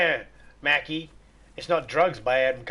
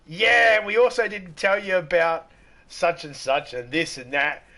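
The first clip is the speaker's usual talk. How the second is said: joking reply; 4 semitones higher, 6 dB louder, slower than usual. Retold or imitated. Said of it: "I went, ... I was just having a joke".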